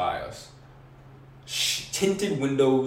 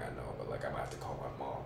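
hushed; 11 semitones lower, 13 dB quieter, faster than usual. Retold or imitated. Retelling anxious plea.

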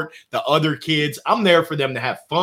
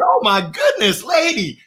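mock exasperation; 7 semitones higher, 3 dB louder than usual. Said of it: "I said".

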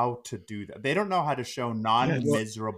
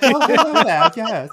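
put-on high, loud posh British accent; 10 semitones higher, 12 dB louder, slower than usual. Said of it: "one of the most beautiful British accents".

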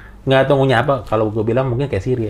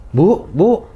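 high, louder call to his wife; 7 semitones higher, 5 dB louder than usual. Retold or imitated. Old grandfather calling to his wife, raised call. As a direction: call her name across the cave, higher and louder.

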